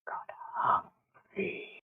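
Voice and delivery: barely audible, drawn-out whisper; eerie creature voice. Acted. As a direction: whisper very slowly with long, haunting pauses. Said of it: "the voice goes".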